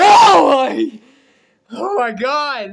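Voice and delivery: loud, high burst of excitement; excited shout. Real, not acted. A fan hearing Gambit returns, excited shout. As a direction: blurt it out overwhelmed with joy.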